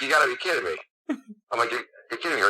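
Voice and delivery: high-pitched replayed disbelief; incredulous, voice lifted; retold disbelief. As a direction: act out being stunned by a caller. Retells disbelief at the surprise call.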